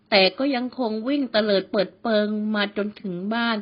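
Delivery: plain reading voice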